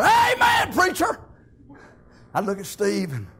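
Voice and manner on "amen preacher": high, loud church holler; hollered amen. Retold or imitated. Old man in the front pew hollering to the preacher, loud. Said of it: "old Denny Burnett would holler".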